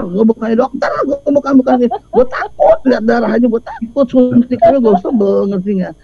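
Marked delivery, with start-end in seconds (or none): none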